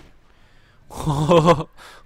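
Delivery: big loud burst of laughter; loud delighted laughing. Real, not acted. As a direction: crack up at the speed. Laughs at sped-up battle.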